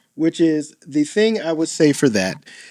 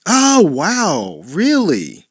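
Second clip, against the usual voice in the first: "Oh, wow, really" with big swooping pitch; up on the end; 2 semitones higher, much slower than usual. Shown as a demonstration. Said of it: "Just go up on the end".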